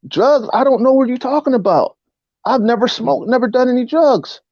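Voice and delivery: high-pitched, frightened protest; panicked disbelief. Retold or imitated. Nineteen-year-old to police who want drugs, panicked pleading. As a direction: retell pleading innocence to the cops, pitch up. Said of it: "I'm like".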